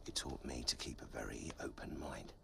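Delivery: low, whispered moral; ominous whisper. Acted. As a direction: drop to a whisper for the lesson. Ominous end of war story.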